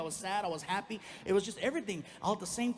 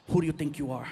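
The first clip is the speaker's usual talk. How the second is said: mock confrontation; 4 semitones lower, 7 dB louder than usual. Rehearsing angry speech at mirror.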